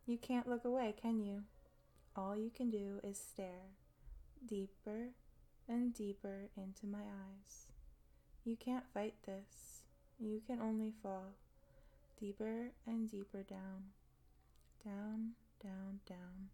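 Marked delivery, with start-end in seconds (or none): 2.1-13.9 slow soft hypnotic voice; hypnotic whisper. Acted. Hypnotic deepening. 14.8-16.5 breathy whispered down, down; whispering. Acted. Whispered trance deepening.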